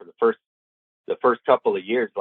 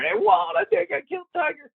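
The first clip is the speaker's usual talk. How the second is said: high yelled call for help; panicked high yell; retold scream. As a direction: shout it across the field in panic. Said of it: "I screamed to Walt".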